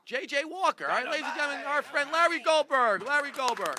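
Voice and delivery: loud, high MC build-up; big rousing stage introduction; announcer introduction. Real, not acted. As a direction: call the act to the stage over applause. Host introduces the comic to applause.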